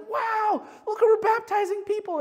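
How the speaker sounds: imitating Josh's wonder; excited. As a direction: gasp at the good news. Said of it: "he's like".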